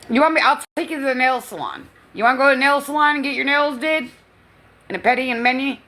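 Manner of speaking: high, loud, quick doting. Real, not acted. Nana offers her dog a nail salon trip, high and doting. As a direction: offer a spa day to the pup.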